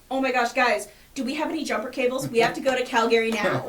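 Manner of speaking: frantic, loud and fast; panicked rush, loud. Retold or imitated. Retelling panicked car trouble.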